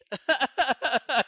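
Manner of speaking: high, loud burst of laughter; real laugh. Real, not acted. Laughing at not being Dutch.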